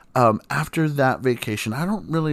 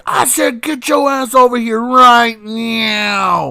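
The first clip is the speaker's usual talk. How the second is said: much higher, louder, slow furious order; imitating angry mom; 10 semitones higher, 6 dB louder, slower than usual. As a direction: bark the order like a livid mother. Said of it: "I could hear it in her voice".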